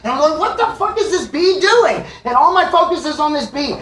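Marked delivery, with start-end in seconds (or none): 0.4-2.0 high, raised, incredulous question; exasperated. Retold or imitated. Man baffled by dancing bee, high exasperated exclamation.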